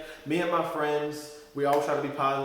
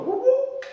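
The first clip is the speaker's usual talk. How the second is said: high, loud whooping police siren sound; siren imitation; 7 dB louder than usual. Imitating the cop's siren as they get pulled over, high whoop. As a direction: mimic a cop car's siren, piercing.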